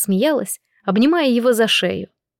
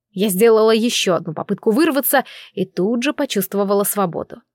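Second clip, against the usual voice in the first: usual speaking voice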